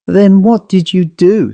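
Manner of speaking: a mildly stern demand. A narrator's reading. Narrator sternly questioning the man about the ghost. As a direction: press him, a touch severe.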